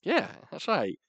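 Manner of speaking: ordinary talk, nothing marked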